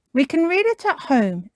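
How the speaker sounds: high, loud cartoon mum; Mummy Pig. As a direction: announce it in a lifted mum voice. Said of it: "says Mummy Pig".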